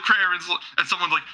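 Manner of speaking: ordinary talk, nothing marked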